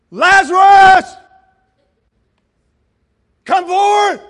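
huge, drawn-out, high shout; full-force commanding yell; loud voice. Acted. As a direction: bellow it to summon someone from afar. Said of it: "in a loud voice".

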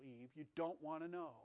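gentle warning. Acted. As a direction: ease her away from it tenderly. God gently warning Eve.

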